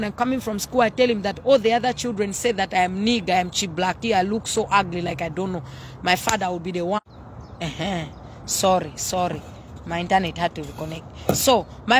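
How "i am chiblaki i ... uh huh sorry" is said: ordinary talk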